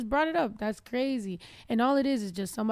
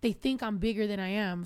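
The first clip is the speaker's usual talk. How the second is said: ordinary delivery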